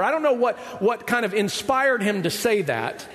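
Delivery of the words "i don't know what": normal talking voice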